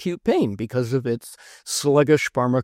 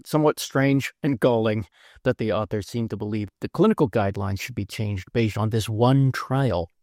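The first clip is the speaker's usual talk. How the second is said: ordinary talk, nothing marked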